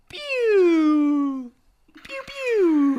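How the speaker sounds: high playful pew pew noise; pew pew sound. Pretend. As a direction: fire little mock blaster sounds. Imitated shooting noise.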